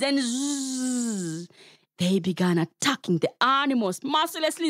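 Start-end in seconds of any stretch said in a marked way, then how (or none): 0.2-1.5 buzzing zzz like an angry swarm; buzzing bees. Acted. Storyteller buzzing the bees for the kids. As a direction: buzz like the bees. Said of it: "angry swarm of bees".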